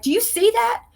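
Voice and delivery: high astonished ask; amazed question. Retold or imitated. Asking her friend if she sees the creature, amazed. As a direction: shoot up high in disbelief.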